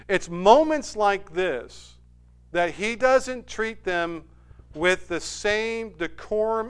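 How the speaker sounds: slow emphatic preaching. Real, not acted. Preacher describing the leaders' pride, slow and heavy. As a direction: stretch each phrase out for weight.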